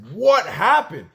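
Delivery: high, loud startled question; retold shock. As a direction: burst out in retold disbelief. Retold shock at seeing face.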